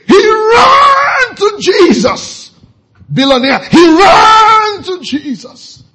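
high, loud, slow preacher's cry. Acted. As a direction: wail it to the congregation. Preacher crying out climax.

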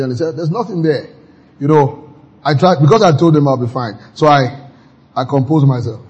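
ordinary talk, nothing marked

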